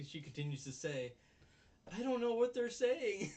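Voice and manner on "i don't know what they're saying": put-on high, louder laughing girly voice; mock clueless girl. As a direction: play her baffled, giggling through it. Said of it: "And she's like".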